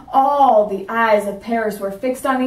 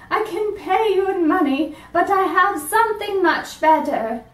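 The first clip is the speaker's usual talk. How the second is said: put-on higher old woman's voice; 8 semitones higher than usual. Old woman offering the dentist something better. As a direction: play a grateful old woman, lifted high.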